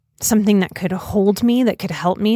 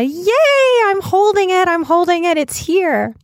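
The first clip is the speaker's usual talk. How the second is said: excited cheer; 11 semitones higher than usual. Retold or imitated. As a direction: squeal it with delight, reenacting your own thrilled message. Retelling her thrilled text to Lisa.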